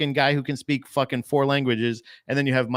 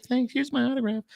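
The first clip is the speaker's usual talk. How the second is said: mock grateful girl; 14 semitones higher than usual. Imagined Anne Frank thanking rescuer.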